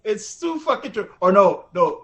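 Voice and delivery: ordinary delivery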